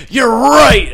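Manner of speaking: hammed-up enlightened shout; mock epiphany. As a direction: blurt it like a revelation. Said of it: "Adam Scott's like".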